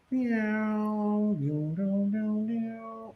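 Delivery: high, soft sung swoops; imitated sound effect. Host mimicking what you hear after the blackout, high sung effect. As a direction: mimic the show's sound cue in high, gliding notes. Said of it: "and then you hear".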